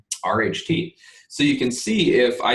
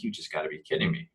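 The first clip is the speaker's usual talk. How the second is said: quick, softer disbelieving mutter; exasperated mutter; 4 semitones lower, 8 dB quieter, faster than usual. Retold or imitated. Trader after a losing trade, mutters quick and soft in disbelief. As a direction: grumble it fast under your breath. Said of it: "I'm like".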